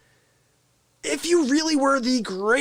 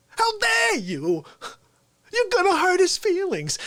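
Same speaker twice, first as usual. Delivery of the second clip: offended Fauci; 9 semitones higher than usual. Retold or imitated. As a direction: take mock offense for your invisible friend. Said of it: "this is what Fauci said back to Adam".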